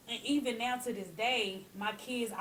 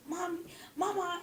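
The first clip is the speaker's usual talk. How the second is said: put-on higher, pleading little boy calling Mama; upset little son; 9 semitones higher than usual. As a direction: play her young son calling out to grandma. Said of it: "He said".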